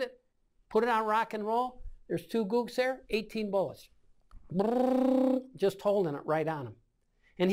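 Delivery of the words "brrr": long high trilled gun burst; sustained rolled brrr of rifle fire; mouth-made burst on full auto; imitated automatic fire.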